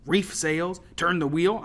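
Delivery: loud scornful questions; heated, rising challenge; angry challenge. A narrator's reading. Angry Fisk challenges her with questions.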